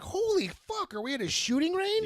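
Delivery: high startled exclamation; mock shock. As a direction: react as if gunfire erupted. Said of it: "I'm like".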